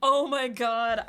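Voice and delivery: slow, breathless through laughter; real laugh. Real, not acted. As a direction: still laughing. After laughing hard.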